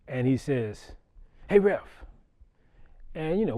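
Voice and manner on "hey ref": loud, high hail across the gym; calling out. Retold or imitated. Coach hails the referee between games, loud call. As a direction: sing out to grab the ref's attention.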